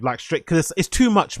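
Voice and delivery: usual speaking voice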